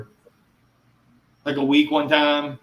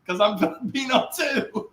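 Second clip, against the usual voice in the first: high, slow, laughing reply; wife giggling over her own heritage; quoting wife; 11 semitones higher, slower than usual. Retold or imitated. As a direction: laugh in the middle of the answer. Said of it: "And she's like".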